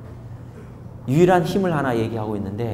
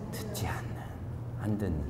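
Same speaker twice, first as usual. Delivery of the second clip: whisper; 6 semitones lower, 16 dB quieter, faster than usual. Real, not acted. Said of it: "prompting congregation answer".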